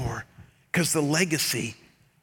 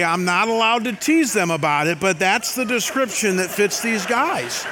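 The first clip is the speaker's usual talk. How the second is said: laughing; 5 semitones higher, 5 dB louder than usual. Real, not acted. Laughing at his own joke.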